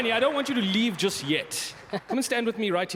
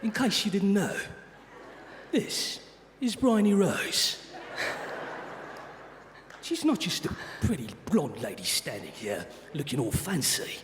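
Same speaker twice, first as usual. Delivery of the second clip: put-on Jason Statham British accent. Host re-introducing the speaker to the audience, in a tough British accent. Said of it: "I'll do the British accent, your whole re-intro, British accent, but as Jason Statham".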